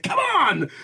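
high-pitched mock scream of exasperation; imitated angry yell. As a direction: yell it out like an outraged ranter, hammed up. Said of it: "he's screaming and shouting".

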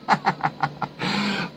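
soft wheezy chuckle; quiet burst of amusement; laughing. Real, not acted. Laughs after "They're freaking.

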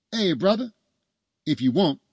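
high, loud, chummy hail; calling across room. A narrator's reading. Doctor calling the new father over, chummy and loud.

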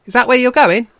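very high, loud otter voice; excited otter. Acted. Otter asks the bears about their journey, loud and excited. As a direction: blurt the question at full volume.

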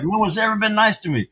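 mock self-pity. Recalling boot camp, mock whine that no one is nice. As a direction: play his younger self feeling sorry for himself.

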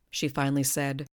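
ordinary delivery, nothing marked

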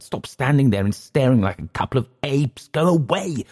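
hammed-up desperate yelling; frantic shout. A narrator's reading. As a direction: yell at them to leave. Clift's outburst continues.

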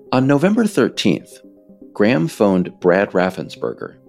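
ordinary talk, nothing marked

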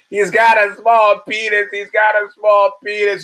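high, loud mock wailing; retold scream. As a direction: imitate a screaming woman's outburst. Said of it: "she's screaming".

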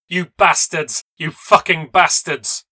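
high, very loud outraged yell; furious scream. A narrator's reading. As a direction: scream it at attackers driving away. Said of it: "she screamed at them".

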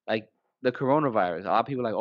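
ordinary talk, nothing marked

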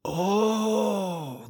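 high gasping "Oh"; a startled gasp, hand to mouth. A narrator's reading. Mother gasps at the photo of her son, startled. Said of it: "the woman gasped".

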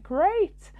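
high, sarcastic "Great."; a bright, sarcastic "Great.". Retold or imitated. Meeting yet another narcissist, she says a sarcastic "Great.". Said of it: "I was like".

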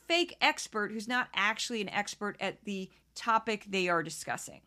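ordinary delivery

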